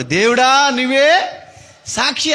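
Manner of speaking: mock plea. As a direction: echo the dying man's words. Recalls the dying man's cry. (తమ్ముడి మొరను అనుకరించడం)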